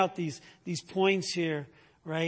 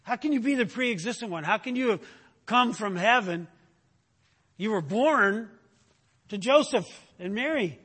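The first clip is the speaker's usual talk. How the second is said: higher, louder, fast incredulous challenge; indignant crowd; 5 semitones higher, 5 dB louder than usual. Retold or imitated. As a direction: throw the objection back at him. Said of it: "they understand that he's saying".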